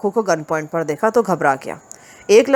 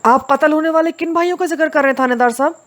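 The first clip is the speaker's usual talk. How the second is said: much higher, urgent question; agitated, excited; 9 semitones higher, faster than usual. Acted. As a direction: ask in a rush of agitation. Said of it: "हैजियानी अंदाज में". (बेचैन, उत्तेजित अंदाज़ में)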